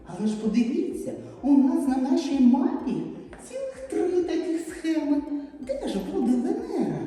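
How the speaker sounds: usual speaking voice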